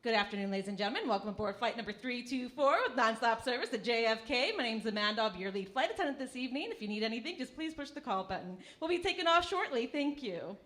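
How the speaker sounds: fast, polished cabin announcement; flight attendant voice. Pretend. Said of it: "so I'm gonna do the voice for you guys".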